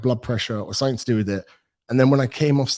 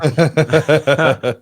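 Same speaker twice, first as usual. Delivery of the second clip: laughing oh; 5 semitones higher, 8 dB louder than usual. Retold or imitated. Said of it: "And I'd be like".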